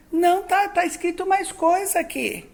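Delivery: put-on a small child pointing at the page, sure of herself; little girl insisting. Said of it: "E eu falava assim". (menina insistindo, voz aguda de criança)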